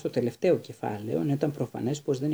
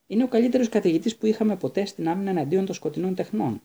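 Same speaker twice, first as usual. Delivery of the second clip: boldly; 6 semitones higher, 3 dB louder than usual. A narrator's reading. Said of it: "είπε θαρετά".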